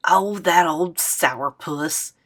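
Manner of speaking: put-on slow, scornful old lady; dismissive grandma. Grandma scoffing at her son's call, slow scorn. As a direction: drag it out with disdain.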